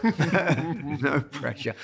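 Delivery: "No pressure" drawn out slowly with a laugh; dry ironic repeat, laughing; sarcastic, slow and laughing. Shown as a demonstration.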